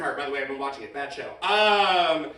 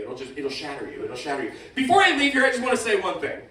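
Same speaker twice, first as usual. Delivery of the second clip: ordinary talk, nothing marked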